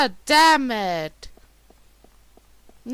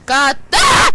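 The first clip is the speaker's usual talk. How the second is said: startled scream; 3 semitones higher, 9 dB louder than usual. Real, not acted. Jump scare in horror game.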